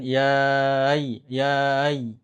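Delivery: yai with no glottal catch, trailing off; wrong hamzah. Shown as a demonstration. Teacher showing the mistaken yai without hamzah. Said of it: "Itu contoh kesalahan".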